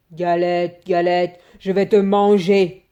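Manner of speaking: big hungry wolf growling the threat. A narrator's reading. Wolf threatening the cake, loud hungry wolf voice. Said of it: "dit le loup".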